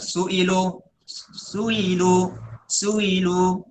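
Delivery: su'ilu slowly, hamzah eased toward ya; slow recitation. Shown as a demonstration. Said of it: "seperti itu mungkin ya". (su'ilu dibaca pelan dan tashil)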